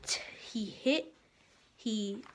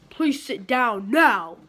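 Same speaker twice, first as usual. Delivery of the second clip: louder, slow stern command; stern order; 9 dB louder, slower than usual. Acted. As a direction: lay down the law slowly. Father orders Froggy.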